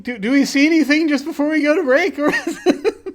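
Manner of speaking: high laughing protest. Real, not acted.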